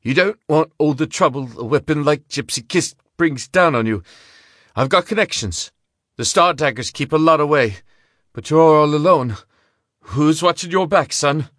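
gambler voice. A narrator's reading. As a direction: talk fast to save yourself. Frightened gambler bargaining.

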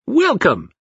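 put-on high, childlike alien voice; toddler voice. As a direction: voice a creepy little child. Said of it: "It sounded like a toddler".

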